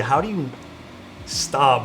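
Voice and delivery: normal talking voice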